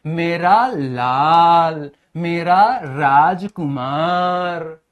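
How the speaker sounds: long, drawn-out loving coo; doting mother. Acted. Teacher imitates a mother adoring her son, slow doting coo. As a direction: stretch each word like a mother cooing over her child. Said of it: "हमसे प्यार करती हैं तो क्या बोलती हैं".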